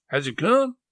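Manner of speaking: high, loud anxious question; aunt bursting with anxious hope; anxious eagerness. Acted. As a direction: pounce on him with the question. Sally jumps for husband.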